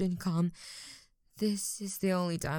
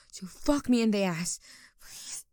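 ordinary talk

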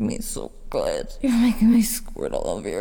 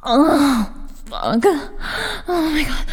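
aroused moaning; 5 semitones higher, 5 dB louder than usual. Acted. Building toward climax.

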